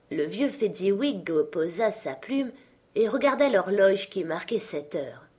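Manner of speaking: ordinary delivery, nothing marked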